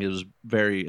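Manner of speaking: usual speaking voice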